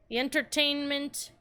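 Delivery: ordinary talk, nothing marked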